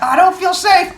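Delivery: high-pitched mock protest; worried kid. Kid objecting to the rescuer, high mock protest.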